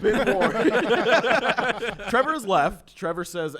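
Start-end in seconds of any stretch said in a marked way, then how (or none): 0.6-2.0 big burst of laughter; loud laugh. Real, not acted. Group laughter at the table.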